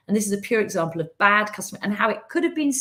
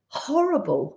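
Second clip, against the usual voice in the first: normal talking voice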